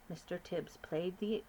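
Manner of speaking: ordinary delivery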